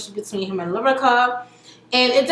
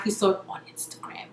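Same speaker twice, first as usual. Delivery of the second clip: conspiratorial whisper; 4 semitones lower, 9 dB quieter, faster than usual. Real, not acted. Gossipy aside about [name].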